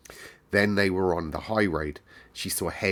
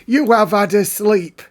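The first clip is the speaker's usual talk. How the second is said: higher, louder, bossier housekeeper voice; Mrs Medlock retry; 12 semitones higher, 10 dB louder than usual. Acted. Narrator retries Medlock waking Mary, high and loud. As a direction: try the housekeeper again, bigger. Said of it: "Let's try that again".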